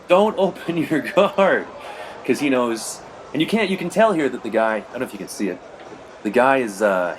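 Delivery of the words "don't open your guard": high, drawn-out mock yell; imitating coach's yell. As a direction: holler it slowly like a coach from the sidelines. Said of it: "is yelling at me".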